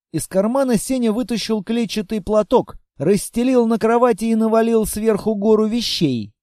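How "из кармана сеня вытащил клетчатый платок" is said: ordinary delivery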